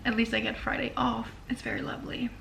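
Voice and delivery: soft unvoiced whisper. Real, not acted. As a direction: breathe it like a secret. Whispered aside about Friday off.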